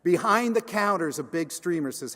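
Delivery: usual speaking voice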